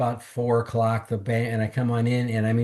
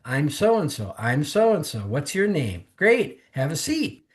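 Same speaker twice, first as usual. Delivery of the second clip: higher-pitched, eager retold greetings; imitated hearty welcome; 4 semitones higher, slower than usual. Three people welcoming a newcomer at the meeting, eager imitation. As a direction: mimic a round of friendly introductions.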